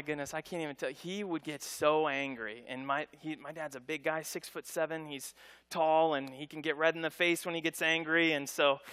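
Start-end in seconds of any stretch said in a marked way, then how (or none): none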